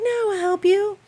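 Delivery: high, breezy offer; eager young guy. Retold or imitated. Canadian guy volunteering to help, a high breezy voice. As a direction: toss off the offer like it's nothing. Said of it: "And he's like".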